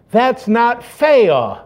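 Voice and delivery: put-on "fair" said as "faiw", slow and high like a little girl; can't say r. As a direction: stretch out "That's not fair" with no R in "fair". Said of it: "She couldn't pronounce her R's".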